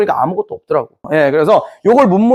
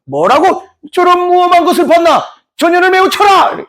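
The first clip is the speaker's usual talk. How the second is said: mock furious order; 11 semitones higher than usual. Magistrate ordering guards to beat her, mock fury. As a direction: bellow like an outraged magistrate.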